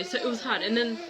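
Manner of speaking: ordinary talk, nothing marked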